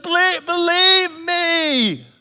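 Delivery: slow, high, loud "Believe, believe me"; pleading Jesus. Retold or imitated. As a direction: beg them to trust you, drawn out. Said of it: "He's like".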